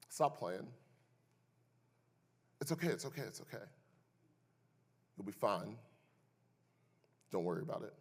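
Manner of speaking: soft, low, dismissive reassurance; mock soothing brush-off. Sister quietly brushes off her brother's pain, mock-soothing. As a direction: hush it lazily, brushing the pain aside. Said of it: "the third thing she said to me".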